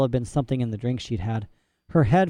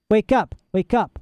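urgent wake-up call; 8 semitones higher than usual. A narrator's reading. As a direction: shake her awake. Girl rousing sleeper.

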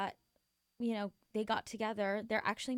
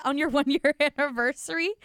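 much louder, higher incredulous question; outraged disbelief; 4 semitones higher, 9 dB louder than usual. Real, not acted. Host reacting to a friend's neglected anniversary, outraged disbelief. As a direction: blurt it out, scandalized for her. Outraged on behalf of girl in story.

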